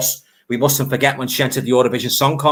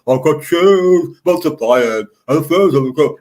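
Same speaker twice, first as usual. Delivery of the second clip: slow, louder hammed-up Cockney crooning; Cockney impression; 3 semitones higher, 5 dB louder than usual. Fan mimics Arthur Mullard's Cockney singing in the Grease spoof, louder hammed-up Cockney. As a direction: sing it like a gruff Cockney bloke in a spoof. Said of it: "in his typical Cockney voice".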